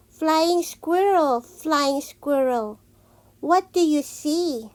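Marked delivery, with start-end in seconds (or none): none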